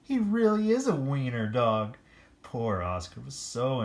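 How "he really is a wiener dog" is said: a hint of high jeering dog; lightly voiced mocking pack; teasing dogs. A narrator's reading. Dogs teasing Oscar, lightly high. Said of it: "they cried".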